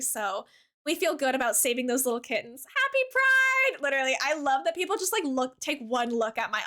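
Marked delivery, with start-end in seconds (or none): 2.7-3.8 excited greeting. Real, not acted. Greeting chat with pride wishes.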